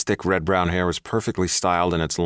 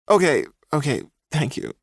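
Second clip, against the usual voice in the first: sniffling; 10 semitones higher than usual. A narrator's reading. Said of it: "he sniffled".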